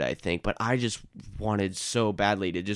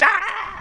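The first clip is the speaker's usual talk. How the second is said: high anguished scream. Pretend. End of frantic survey rant.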